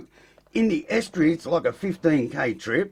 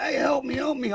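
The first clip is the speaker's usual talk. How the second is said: higher, half-joking cry for help; mock panicked plea; 9 semitones higher than usual. Teen stuck in crashed car calling to his mate, mock panic. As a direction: play up the kid's panic, pitched high.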